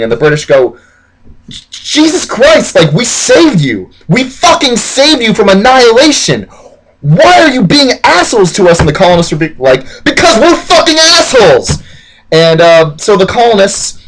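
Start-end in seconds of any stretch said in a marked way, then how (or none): 1.7-8.8 high-pitched, hammed-up indignation; exasperated. British scolding colonists after saving them, high exasperated protest. 10.0-11.8 high hammed-up shouting; proudly defiant yell. Colonists answering the British, high shameless yell.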